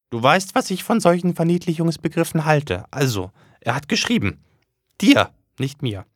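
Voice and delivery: high prim mother voice. Acted. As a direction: lecture the son primly. The mother speaks.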